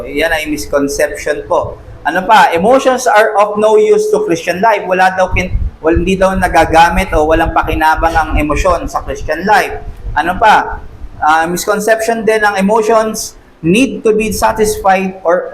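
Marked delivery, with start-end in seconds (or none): none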